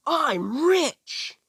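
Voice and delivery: a giddy, piercing cry of delight; ecstatic shout. Acted. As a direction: scream it like you just won the lottery. Naruto learns he's suddenly rich.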